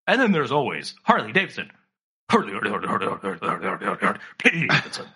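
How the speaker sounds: low, chugging repeated Harley; Harley engine imitation. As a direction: chant Harley like an idling motorbike. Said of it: "he finished the joke with".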